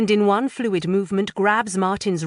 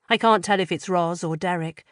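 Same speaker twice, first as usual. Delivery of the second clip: ordinary delivery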